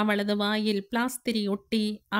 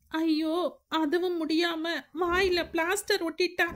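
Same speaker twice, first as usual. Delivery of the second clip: put-on higher, helpless girl's voice; distressed young woman; 7 semitones higher than usual. Anu explaining to Siva she couldn't see, distressed. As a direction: plead that you could not see or cry out.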